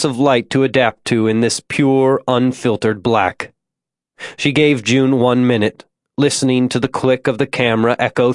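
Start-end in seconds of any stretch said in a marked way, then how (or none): none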